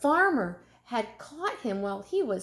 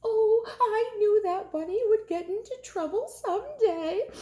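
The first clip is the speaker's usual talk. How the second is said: high tearful wail; a weeping mother, voice crying; 11 semitones higher than usual. Acted. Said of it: "wept Mama".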